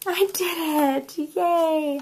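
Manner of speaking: high, drawn-out little cheer; playful triumph. Real, not acted. Crafter cheering herself after a tricky step, high sing-song triumph. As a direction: celebrate a small win slowly in a high voice. Celebrates getting the punch to work.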